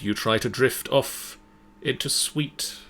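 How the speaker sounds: usual speaking voice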